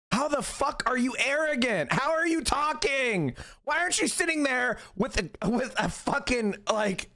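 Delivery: ranting high at arrogant liberals; exasperated outburst. Real, not acted.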